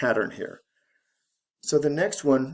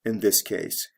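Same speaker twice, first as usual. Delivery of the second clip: ordinary talk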